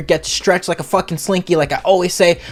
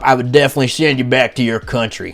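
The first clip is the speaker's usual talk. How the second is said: Trump impression; 5 semitones lower than usual. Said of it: "if I was Trump".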